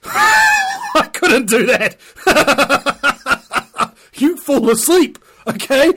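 breaking into high, loud laughter; laughing. Real, not acted. Joking about heavy smokers, cracking up loudly. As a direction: laugh through the words.

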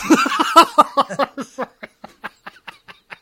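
loud, high burst of laughter; real laughter. Real, not acted. Teller cracking up at own coconut story, loud laugh.

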